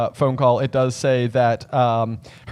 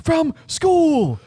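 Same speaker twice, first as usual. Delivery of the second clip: high-pitched shout, word by word; Ron; 13 semitones higher, slower than usual. Acted. Wizard boy introducing himself by phone, slow loud shout. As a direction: call slowly and loudly across a great distance. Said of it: "loud and clear".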